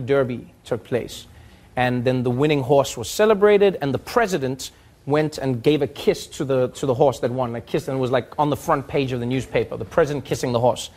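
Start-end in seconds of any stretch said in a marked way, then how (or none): none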